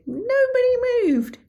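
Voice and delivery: high, slow disbelieving outrage; indignant. Real, not acted. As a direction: stretch it out in disbelief. Indignant about bus seat.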